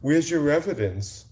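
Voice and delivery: high, mocking echo of his question; sarcastic imitation of the troll; mocking quote. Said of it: "And you see how you talk to me?".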